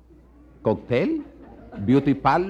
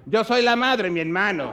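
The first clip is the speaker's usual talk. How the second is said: higher, offended mother voice; mother replying; 5 semitones higher than usual. Acted. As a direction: bristle back as the mother. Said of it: "mother answers". (la madre ofendida, voz más aguda)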